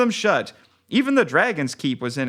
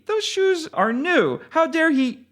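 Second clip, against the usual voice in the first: outraged; 11 semitones higher than usual. Acted. Outraged over his new shoes.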